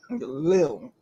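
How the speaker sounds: lil, clipped short for little; lil pronunciation. Shown as a demonstration.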